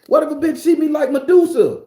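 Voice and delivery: loud, high-pitched rant; comic alarmed yell. Acted. Ranting about being seen as Medusa, yelling.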